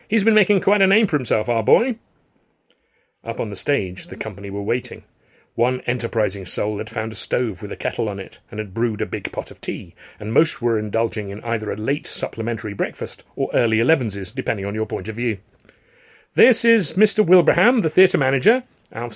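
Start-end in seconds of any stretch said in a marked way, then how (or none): 0.1-2.0 high, hearty boast; calling back. A narrator's reading. Alf calls back to the manager, hearty. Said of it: "Alf called back". 16.3-18.6 high, loud presenting voice; public introduction. A narrator's reading. Alf introduces manager to company, announcing.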